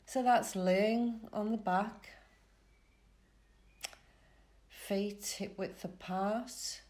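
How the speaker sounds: calm relaxation guide with long pauses; soothing Yoga Nidra voice, spaced phrase by phrase; guided meditation. Real, not acted. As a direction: lead them gently into rest, unhurried.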